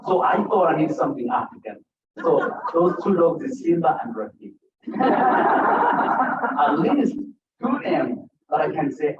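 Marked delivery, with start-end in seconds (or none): 4.9-6.6 a high amused laugh. Real, not acted. Father laughing at naming dogs Simba and Rafiki, a high laugh. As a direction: laugh high at your own joke. Laughs about dog names.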